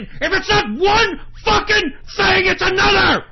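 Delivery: high, loud screaming rant; explode, punching out each phrase; real furious shout. Real, not acted. Broadcaster sick of endless trouble, furious high yelling. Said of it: "I'm so fucking pissed off".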